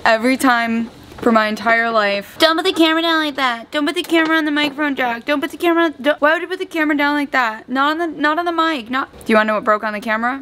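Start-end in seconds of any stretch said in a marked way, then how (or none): 2.4-9.1 higher, quicker mock scolding; needling impression of a fussy partner; mocking the nagging partner. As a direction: parrot his nagging, high and fast. Mimics partner nagging about camera.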